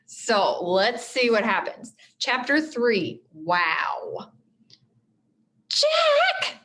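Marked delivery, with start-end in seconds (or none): 5.7-6.6 high, sharp cry of a name; cried out. A narrator's reading. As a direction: call out his name in alarm. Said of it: "cried Annie".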